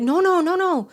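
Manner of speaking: imitating bystanders, high and loud rapid-fire; alarmed refusal. Bystanders blocking her, retold.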